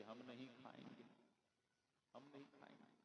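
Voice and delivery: very soft acted protest; fading, timid captive's objection; meek refusal. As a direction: refuse in a small, hushed voice. Captive refusing food, acted very softly.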